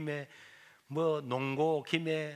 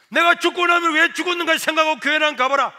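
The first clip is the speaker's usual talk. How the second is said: higher, louder declaration; loud vow; 9 semitones higher, 12 dB louder than usual. Retold or imitated. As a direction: proclaim it with full force. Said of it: "선포를 했어요". (큰 소리로 선포)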